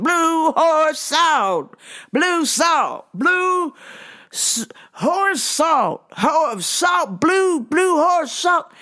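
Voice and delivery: mock customer yell, high and drawn out; imitated yelling. Customer to worker four feet away, yelling. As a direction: bellow each word like he can't hear himself. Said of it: "but then would yell".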